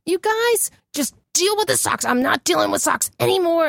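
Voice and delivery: high exasperated rant. Retold or imitated. Mom ranting at her family about socks. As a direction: throw up your hands at the socks. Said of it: "I'm like".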